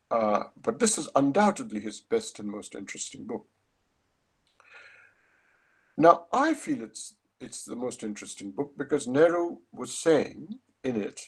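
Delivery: usual speaking voice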